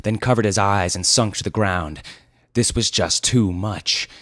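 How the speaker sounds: usual speaking voice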